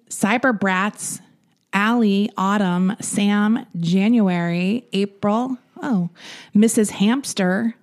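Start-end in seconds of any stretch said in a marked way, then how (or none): none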